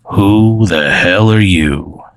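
slow, drawn-out creature challenge. Acted. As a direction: draw it out like a waking little creature. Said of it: "this voice says".

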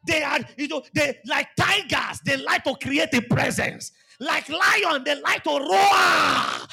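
loud, rising preacher's cry; full-force pulpit preaching; fiery preaching. Acted. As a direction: drive the sermon home with force.

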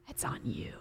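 breathy whisper, pointed; a quiet accusing whisper; whispered blame. Real, not acted. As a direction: hiss the blame at them.

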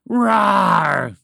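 loud, high storybook dragon roar. A narrator's reading. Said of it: "the dragon roared at the quicksand".

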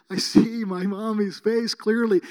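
put-on a boy seeing his mommy's face, in awe; childlike wonder. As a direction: say it as a child marvelling. Boy seeing mother clearly.

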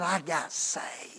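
slow whisper; a hushed, slow whisper; whispered confession. Retold or imitated. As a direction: breathe it out like a secret. The man's quiet confession.

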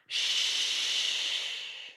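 soft unvoiced shush; whispered shush. Raggedy Ann hushing Raggedy Andy, whispered. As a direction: breathe the shush out without voice. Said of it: "whispered Raggedy Ann".

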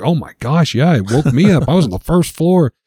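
bubbling over with the news; excited chatter. Retold or imitated. Bandmates eagerly telling about the night noise, excited.

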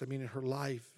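usual speaking voice

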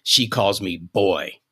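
normal talking voice

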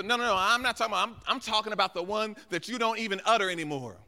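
emphatic preaching. Real, not acted. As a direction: push back on the wrong idea with force.

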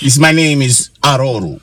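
put-on African accent. Husband speaks as the car giving its name, African accent. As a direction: introduce yourself in a mock African accent. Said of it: "gotta say it in an African accent".

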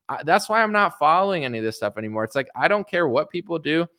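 ordinary talk, nothing marked

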